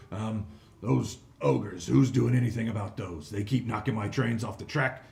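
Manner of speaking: loud, bossy crime-lord hobgoblin; greedy hobgoblin boss. Acted. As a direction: bark orders like a greedy fat boss. GM voices the fat hobgoblin boss.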